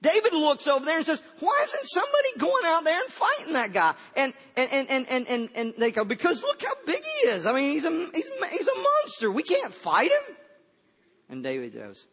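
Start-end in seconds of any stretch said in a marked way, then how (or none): 1.4-4.0 high-pitched, acted outcry; hammed-up incredulous shout; indignant retold question. Acting David's outraged question. 6.0-10.4 high, squealing excuse; hammed-up alarm at a monster; mock panic. Acting the frightened army's excuse.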